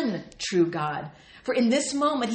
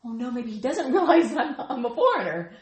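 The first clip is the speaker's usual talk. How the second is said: nervous laughing Ruth; 4 semitones higher, 6 dB louder than usual. Acted. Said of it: "maybe she thinks somehow".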